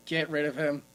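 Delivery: ordinary talk, nothing marked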